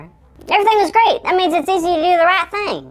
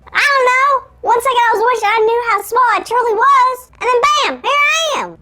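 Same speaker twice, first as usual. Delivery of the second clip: squeaky, higher and excited; cartoon elf voice; 9 semitones higher than usual. Shrunken Carl explaining to Jada, squeaky elf voice. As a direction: blurt it out like a tiny elf. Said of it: "sound like a cartoon elf".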